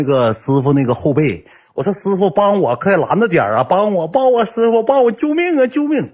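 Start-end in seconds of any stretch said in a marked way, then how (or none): 1.9-6.1 high-pitched plea climbing to a squeal; shouting for help. Retold or imitated. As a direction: act out frantic cries for help, rising higher and higher. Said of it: "我当时大喊". (模仿尖声大喊救命)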